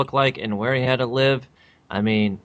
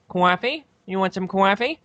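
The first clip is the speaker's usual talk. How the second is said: high mock New York "Coffee?" as "cwoffee"; teasing high New York accent; 7 semitones higher than usual. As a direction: ham up a New York "cawfee". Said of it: "being like".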